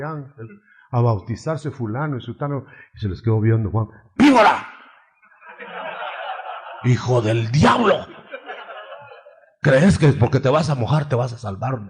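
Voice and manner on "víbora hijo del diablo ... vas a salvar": furious shout. Acted. Juan rebuking the people who come to be baptized, furious shout. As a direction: thunder at them like a prophet calling out hypocrites.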